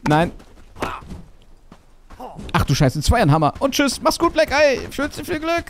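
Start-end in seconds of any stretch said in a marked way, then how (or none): 3.6-5.6 very high, sing-song goodbye; mock falsetto farewell. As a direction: trill a cheeky farewell.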